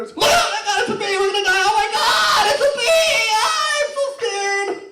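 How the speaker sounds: high, loud hammed-up terrified shrieking; squealing high-pitched mock terror; mock panic. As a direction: overplay the fear of a bee, almost crying. Comedian mimics his own panic.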